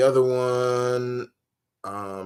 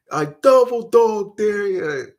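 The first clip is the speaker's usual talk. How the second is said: put-on slow, higher kid's dare; quoting A Christmas Story; 9 semitones higher, 5 dB louder, slower than usual. Fan quoting the movie dare, slow high kid voice. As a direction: drag out the dare like a schoolyard kid.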